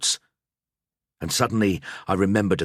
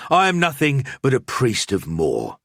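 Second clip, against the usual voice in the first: louder, higher, pressing urgency; urgent priest; 6 semitones higher, 3 dB louder than usual. A narrator's reading. Priest pulling his friend away, urgent and louder.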